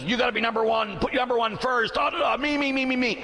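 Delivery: high, pushy me-first chant; mock the self-centred world in a high, insistent voice. Preacher mimicking what the world says, high and insistent. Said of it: "The world says".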